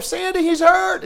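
high, loud, drawn-out call; calling out in panic. Retold or imitated. Dad calling his wife Sandy to come, high panicked call. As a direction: shout for your wife across the room. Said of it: "he calls his wife".

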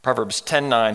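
usual speaking voice

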